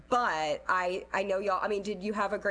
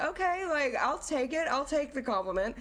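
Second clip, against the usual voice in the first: accepting praise; 7 semitones higher than usual. Retold or imitated. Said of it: "I'm like".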